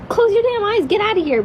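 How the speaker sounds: put-on high, sharp scolding of a kid; irritated mom snap. Mom imitating herself shooing kids from her bedroom, irritated and high. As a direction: bark it at the kid who barges in. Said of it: "I don't have to be like".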